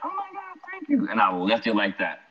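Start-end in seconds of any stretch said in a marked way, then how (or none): 0.0-1.1 mock girlish thanks, pitched way up; imitating the girl. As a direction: squeal it out like the grateful girl at the bar. Girl thanking him for drinks.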